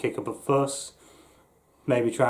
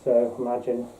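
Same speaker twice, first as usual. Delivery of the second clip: talking through a full-face mask; muffled through mask; faster than usual. Real, not acted. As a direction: voice muffled behind a latex mask. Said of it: "my voice is gonna be muffled through the mask".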